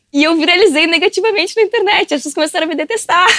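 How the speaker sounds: high-pitched, breaking into laughter; amused. Real, not acted. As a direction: tell it through rising laughter. Laughing at her own viral fiasco. (contando entre risadas, voz aguda)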